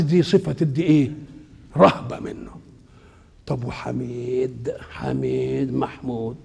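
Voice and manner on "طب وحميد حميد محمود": slow breathy whisper to himself. Real, not acted. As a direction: murmur the word under his breath. Whispered aside during lesson.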